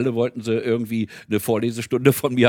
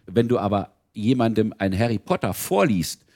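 normal talking voice

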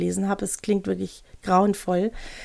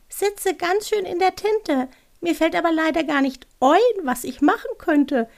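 little owl voice; 8 semitones higher than usual. Acted. Owl talking to itself in trouble, high little creature voice. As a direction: play a tiny owl stuck in trouble, pitched right up. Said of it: "Eine sehr leise Stimme".

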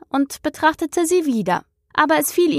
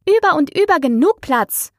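high, louder indignant retort; 7 semitones higher, 4 dB louder than usual. A narrator's reading. Alice answers the tea party, indignant and louder. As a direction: snap back, put out and loud. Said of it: "sagte Alice unwillig".

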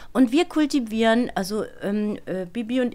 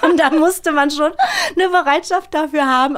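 higher and louder through laughter; laughing while talking; 8 semitones higher, 7 dB louder than usual. Real, not acted. Actress recalling the friendship talk, laughing hard. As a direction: laugh the words out, nearly in tears. Laughing about ten minutes of compliments.

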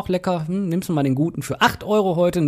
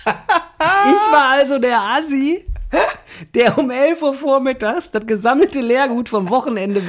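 laughing self-mockery; 8 semitones higher, slower than usual. Real, not acted. Man admitting to his co-host he looked trashy, laughing self-mockery. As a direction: confess it through laughter, voice pushed up.

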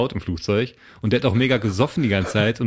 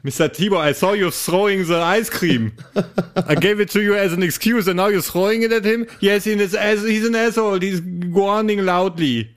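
higher, prim English attendant voice scolding a passenger; tut-tut at the rude passenger, pitched up; scolding flight attendant; 7 semitones higher than usual. Acted. Flight attendant scolds the rowdy passenger, high and prim.

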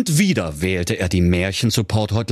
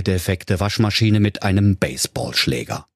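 ordinary delivery, nothing marked

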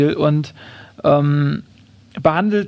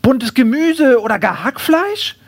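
high, slow, hopeful suggestion; pitched-up, lingering kangaroo voice; polite kangaroo; 7 semitones higher, slower than usual. Acted. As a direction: draw out the question as a courteous kangaroo. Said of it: "sagt das Känguru".

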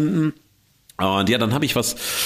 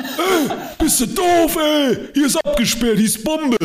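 shouted mock angry official voice, much higher. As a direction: bark it like a furious guard, pitched way up. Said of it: "rief mir jemand her". (wütender Ordnungshüter, hoch und laut nachgeäfft)

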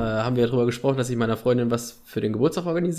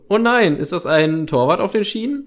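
mock alarm; 6 semitones higher, 4 dB louder than usual. Playing the shocked train driver.

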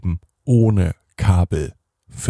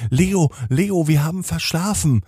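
higher, hurried alarm of a mother waking her son; aufgeregte Mama; 8 semitones higher than usual. Acted. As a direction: call him awake in a rush. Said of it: "sagt Mama". (aufgeregt und höher als Mama, die Leo weckt)

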